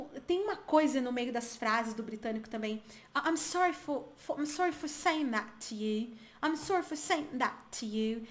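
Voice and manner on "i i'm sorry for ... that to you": British accent, nose in the air; snooty British accent. Shown as a demonstration. Teacher imitating how stuck-up British phrases sound, British accent. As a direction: mimic a slightly stuck-up Briton. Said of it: "Ele é empinadinho pra falar".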